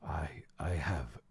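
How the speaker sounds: hushed, halting whisper. Acted. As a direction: answer in a small whisper. Humbled before the god.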